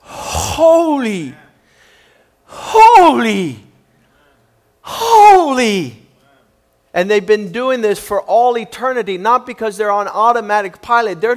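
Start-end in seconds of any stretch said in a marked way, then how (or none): 0.0-6.1 loud, drawn-out cry falling from high; awestruck freaking out. Acted. Preacher acts angels freaking out at God's presence, awestruck cry. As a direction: cry HOLY out, overwhelmed by majesty. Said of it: "these guys are freaking out".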